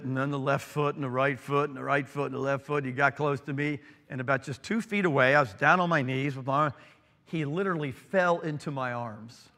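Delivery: ordinary talk, nothing marked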